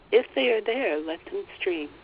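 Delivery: ordinary talk, nothing marked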